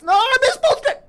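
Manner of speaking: high, loud yell of frustration; frustrated shout. Real, not acted. Player yelling at the game after missing the bonus, a high, loud shout. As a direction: howl at the miss.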